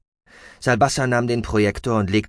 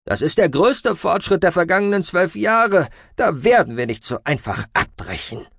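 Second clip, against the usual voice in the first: high, loud creaky voice; forceful, creaky objection dropping at the end; creaky-voiced Aspartamin; 9 semitones higher, 5 dB louder than usual. A narrator's reading. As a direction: protest indignantly in a creaky high voice. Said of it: "erklang Aspartamins knarrende Stimme".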